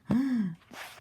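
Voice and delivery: sharp high gasp; high startled gasp. Retold or imitated.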